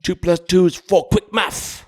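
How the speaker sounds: high-pitched mock Cockney catchphrase; Cockney accent. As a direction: throw it out in a street London accent. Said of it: "like the cockneyed".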